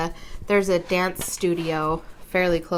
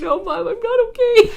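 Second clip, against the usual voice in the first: put-on high, teary kid voice, much higher and louder; crying little boy; 8 dB louder than usual. Mom retelling her son's teary answer in the car, high crying kid voice. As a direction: imitate a small boy on the verge of tears. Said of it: "And he's like".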